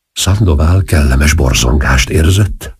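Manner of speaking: ordinary delivery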